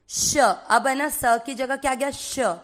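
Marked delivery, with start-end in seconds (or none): none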